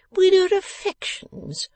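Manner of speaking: high, drawn-out mocking echo; ineffable contempt. A narrator's reading. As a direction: throw the words back with utter contempt. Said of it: "retorted Mister Boffin with ineffable contempt".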